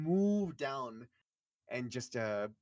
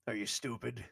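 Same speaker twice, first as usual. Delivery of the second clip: scornful character; 4 semitones lower than usual. Acted. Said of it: "he goes".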